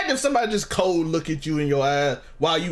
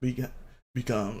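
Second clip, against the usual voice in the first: much softer and lower; quietly, dead in the eye; cold calm order; 5 semitones lower, 8 dB quieter than usual. Retold or imitated. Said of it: "look you dead in your eyes".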